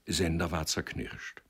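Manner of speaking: ordinary delivery, nothing marked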